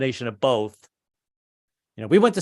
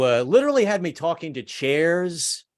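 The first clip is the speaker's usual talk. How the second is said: ordinary talk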